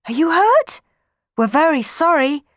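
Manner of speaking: put-on anxious children. Children gathered round Sammy, high anxious voices. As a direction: fuss over him anxiously. Children fussing over fallen boy.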